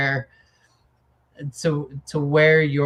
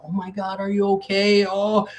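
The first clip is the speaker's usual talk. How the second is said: higher, mock-cooing over a fallen child; doting parent; 5 semitones higher, 3 dB louder than usual. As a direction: imitate a fussing, over-soothing parent.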